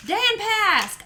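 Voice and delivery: high, loud happy shout of a name; excited greeting. Real, not acted. Streamer greeting a chatter by name with a loud, happy call.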